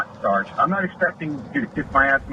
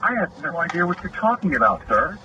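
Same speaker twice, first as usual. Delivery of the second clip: high, sarcastic "sir", with a smirk in the voice; mock the cop's smug innocence; smirking, fake-polite denial; smug mock politeness; 7 semitones higher than usual. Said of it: "rolled his eyes and smiled and goes".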